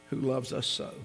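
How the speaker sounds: ordinary talk, nothing marked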